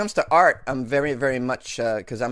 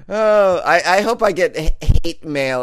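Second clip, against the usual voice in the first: loud taunting; 5 semitones higher, 9 dB louder, slower than usual. Real, not acted. Taunting critics.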